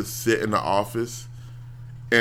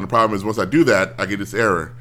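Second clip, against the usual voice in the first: ordinary talk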